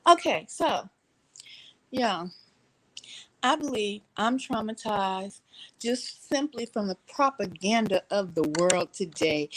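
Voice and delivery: normal talking voice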